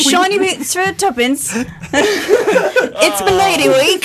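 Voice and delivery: high, slow laughing British hawker; mock British accent. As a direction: hawk it like a Cockney flower seller. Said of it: "sarcastic, weird British and Australian accents".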